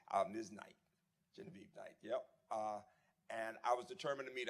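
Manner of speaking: ordinary talk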